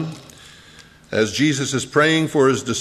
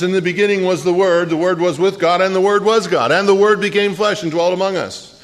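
high, loud proclaiming of scripture; fervent preaching; 5 semitones higher, 4 dB louder, faster than usual. Acted. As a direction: declaim it like a revival preacher. Preaching scripture at full force.

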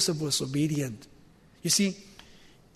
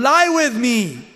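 loud, high, drawn-out urging; insistent seductress; 5 semitones higher, 7 dB louder, slower than usual. Acted. Wife grabbing hold of Joseph, loud insistent urging. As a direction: stretch it out slowly, high and loud.

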